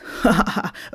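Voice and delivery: short self-amused chuckle; mocking laugh. Big-bellied Santa laughs at Sinterklaas, mocking. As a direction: laugh at your own jibe.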